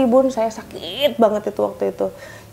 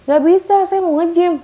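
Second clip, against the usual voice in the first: higher, louder refusal; raised refusal; 7 semitones higher, 6 dB louder than usual. Retold or imitated. Wife refusing her husband over the car, raised voice. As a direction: insist on it loudly.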